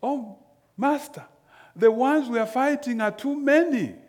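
high, loud, panicked plea to his master; frightened servant. Acted. Servant crying out to Elisha in fear before the battle, high and loud. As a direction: cry out in alarm at the odds. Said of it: "And the servant says".

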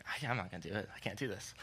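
put-on an eight-year-old backing out at the top of the waterfall, scared and quiet; scared refusal. Boy frozen atop a waterfall telling his brothers no, scared and quiet. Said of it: "my fear's kicking in".

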